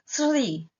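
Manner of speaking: SRI with effort on the joined S-R; struggled SR cluster. Shown as a demonstration. Teacher showing what she cannot say, effortful SRI. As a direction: try hard to force S into R in Sri. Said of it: "S R I itu sulit banget aku baca".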